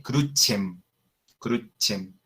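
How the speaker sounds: crucem as "KROO-chem", c like ch; Latin pronunciation. Shown as a demonstration. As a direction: crucem with an Italianate ch. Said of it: "se pronunciaría la, con la E".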